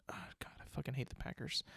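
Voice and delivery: hissed under the breath, fast; angry whisper. Real, not acted. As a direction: spit it out in a sharp fast whisper. Bitter aside about hated rival.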